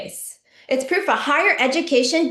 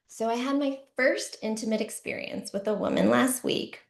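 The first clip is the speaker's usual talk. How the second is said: ordinary talk, nothing marked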